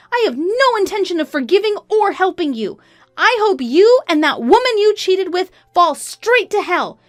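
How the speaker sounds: high, loud, sustained rage; furious tirade. A narrator's reading. Wife cutting off her cheating husband, furious. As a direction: tear into them without holding back.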